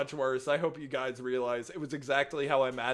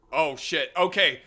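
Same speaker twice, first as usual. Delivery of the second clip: ordinary talk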